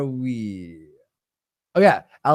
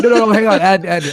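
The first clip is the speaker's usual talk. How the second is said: louder imitation of someone cutting in; imitating a pleading buddy, raised voice; mock urgent interjection; 5 semitones higher, 7 dB louder, much faster than usual. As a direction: act out a hurried, insistent interruption.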